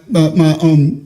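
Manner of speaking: ordinary talk, nothing marked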